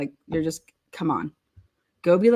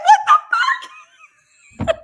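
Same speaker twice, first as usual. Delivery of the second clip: much higher, drawn-out, laughing shriek; shocked laugh; 6 dB louder, much slower than usual. Real, not acted. Reading the paperwork, high laughing shriek. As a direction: shriek it in disbelief, stretching it.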